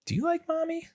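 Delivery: put-on very high little-girl questions; imitating daughter. Dad retelling his daughter asking about mommy, high childlike. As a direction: squeak it like a curious small kid. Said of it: "she goes".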